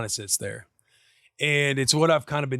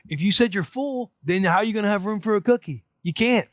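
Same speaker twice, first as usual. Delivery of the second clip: put-on higher, louder reasoning with a toddler; arguing dad; 6 semitones higher, 3 dB louder than usual. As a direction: catch the kid in her own logic. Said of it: "I was like".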